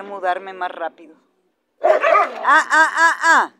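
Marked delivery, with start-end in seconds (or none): 1.8-3.5 loud, high repeated warning sounds; sharp correction. Real, not acted. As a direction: stop the dog with insistent staccato cries. Stopping a misbehaving dog.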